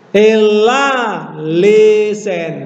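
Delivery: teacher sounding out Greek; Greek reading. Shown as a demonstration. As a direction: read ela lesen with the la rising. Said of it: "Tapi lanya naik".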